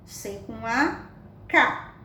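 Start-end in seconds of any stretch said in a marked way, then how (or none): none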